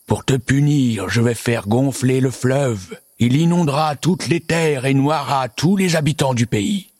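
high, slow, menacing monster voice; angry monster pronouncing a punishment; threatening monster. A narrator's reading. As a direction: draw out the threat like a horrible monster. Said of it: "un horrible monstre apparut et se mit à hurler".

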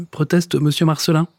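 plain reading voice

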